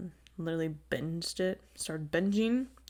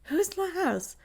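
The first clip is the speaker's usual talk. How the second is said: mock kid voice; 11 semitones higher than usual. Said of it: "the boys are all, like, fighting".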